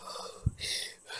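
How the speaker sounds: long blown-out breath, acting out labor. Woman in labor trying to breathe, acted heavy exhale. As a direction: imitate breathing through a contraction. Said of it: "like, trying to breathe".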